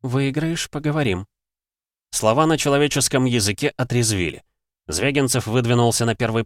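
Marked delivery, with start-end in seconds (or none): 0.0-1.3 cooing. Acted. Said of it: "проворковала".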